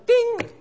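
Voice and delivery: high piercing bell ding; ping like a flight call bell; high mock bell chime. Pressing call button.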